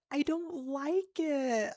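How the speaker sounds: high, loud, drawn-out whine; dragged-out, loud protest; whiny complaint. Real, not acted. Complaining about eating paper.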